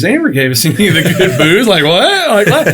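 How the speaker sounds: rising, laughing protest; laughing mock indignation. Joking they never got the good booze, laughing indignantly. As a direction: protest through laughter, pitch climbing.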